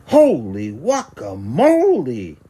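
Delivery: loud, slow astonished cry; exclaiming. A narrator's reading. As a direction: gasp it at the mirror. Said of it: "exclaimed Skippy John Jones".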